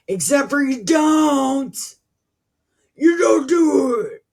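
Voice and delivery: loud, high-pitched angry rebuttal; exasperated shout. Real, not acted. Reader calling out people who promise to obey, exasperated shout. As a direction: yell it at them in exasperation.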